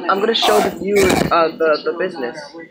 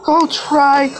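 frightened; 8 semitones higher than usual. Real, not acted. Frightened during game.

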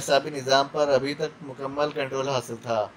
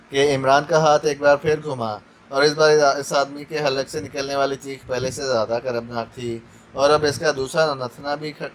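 usual speaking voice

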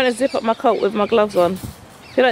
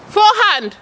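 called out; 8 semitones higher, 4 dB louder than usual. Real, not acted. Coach calling out shots.

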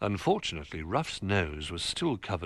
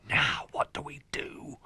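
high, whispered worry; anxious stage whisper; 7 semitones higher than usual. Acted. Little Bear asking the toys in secret, anxious whisper. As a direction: whisper urgently to fellow plotters.

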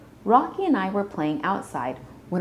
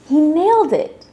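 loud, high, delighted exclamation; triumphant; 9 semitones higher, 10 dB louder than usual. A narrator's reading. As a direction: cheer the success, stretching the key word. Celebrates the successful hairstyle.

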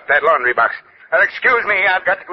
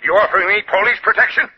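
ordinary talk, nothing marked

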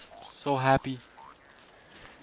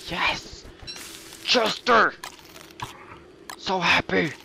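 excited; 7 semitones higher, slower than usual. Real, not acted. Excited at pet.

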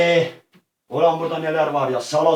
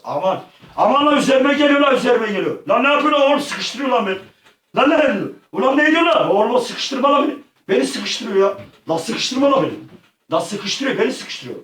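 panicked shouting; 8 semitones higher, 3 dB louder than usual. Real, not acted. Attacked in game.

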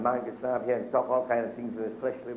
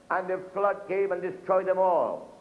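higher, proclaiming preacher's read; scripture reading; 5 semitones higher than usual. Acted. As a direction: lift the verse up to the church. Reads Luke in preaching voice.

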